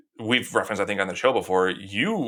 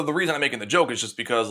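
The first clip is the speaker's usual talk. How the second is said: ordinary talk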